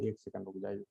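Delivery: quiet aside. Real, not acted. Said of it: "aside while setting up screen".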